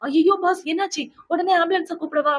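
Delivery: high, loud panicked yelp to the boss; alarmed cry. Retold or imitated. Assistant panicking over his boss, high loud alarmed cry. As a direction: cry out in fright for an ambulance.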